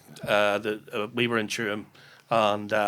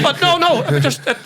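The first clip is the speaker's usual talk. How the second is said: laughing much higher and louder while protesting; 7 dB louder than usual. Real, not acted. Defending his story to co-hosts, laughing high and loud. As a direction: protest through a burst of laughter.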